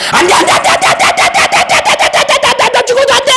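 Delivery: high, repeated shouted refusals; emphatic no. Acted. Pastor forbids bad speech, repeated high shouting. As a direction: hammer every "안 돼" home.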